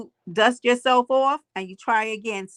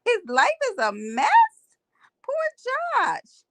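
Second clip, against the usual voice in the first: incredulous; 14 semitones higher, slower than usual. Retold or imitated. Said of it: "I said".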